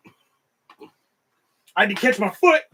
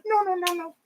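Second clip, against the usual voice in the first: much higher, light and dismissive; little woman's voice; 10 semitones higher than usual. Retold or imitated. Small woman laughing off the near-kick, high light voice. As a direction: lightly imitate a tiny lady brushing it off. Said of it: "and she goes".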